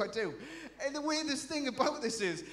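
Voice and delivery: ordinary talk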